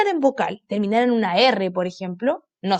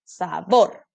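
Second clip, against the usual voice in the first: "Sabor" lower, split sa-BOR; stress on last syllable; 5 semitones lower than usual. Shown as a demonstration. Teacher confirms the tonic syllable, "Sabor" stressed on BOR. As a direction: settle on a firm BOR in "Sabor". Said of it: "última".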